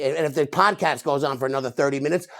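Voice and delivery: normal talking voice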